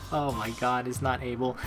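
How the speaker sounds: normal talking voice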